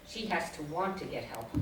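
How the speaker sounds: very quiet, low and dry; deadpan. Retold or imitated. Husband confiding in the psychiatrist about his wife, deadpan. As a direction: imitate a straight-faced husband, soft and matter-of-fact. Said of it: "then he's deadpan again".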